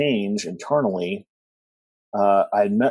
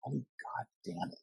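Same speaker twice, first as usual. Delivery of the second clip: very soft, slow curse under the breath; hushed, drawn-out reenacted dismay; retold dismay; 5 semitones higher, 18 dB quieter, slower than usual. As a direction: mutter it to yourself, sinking. Retold dread at seeing the binder.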